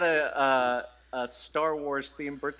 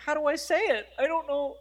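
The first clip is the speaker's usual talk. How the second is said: helpless fretting; 10 semitones higher than usual. Retold or imitated. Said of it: "I was like".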